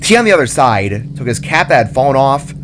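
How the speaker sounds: usual speaking voice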